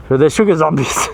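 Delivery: cracking up at the end of the phrase; laughing. Real, not acted. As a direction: say it and break into real laughter. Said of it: "I can't say that without laughing".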